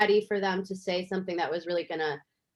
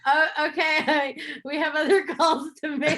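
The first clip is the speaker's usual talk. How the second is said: laughing through quote; 7 semitones higher than usual. Retold or imitated. Programmers ending the call on her crying, laughing imitation. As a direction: giggle while imitating them wrapping up.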